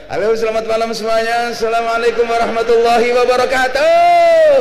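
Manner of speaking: high, loud showbiz stage greeting; celebrity singer greeting. Shown as a demonstration. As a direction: greet the crowd like a pop star on stage. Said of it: "salam gaya artis, salam gaya penyanyi". (salam gaya artis penyanyi, dhuwur lan banter kaya nang panggung)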